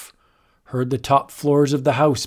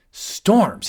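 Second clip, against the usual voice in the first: demanding; 6 semitones higher than usual. Acted. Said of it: "he demanded".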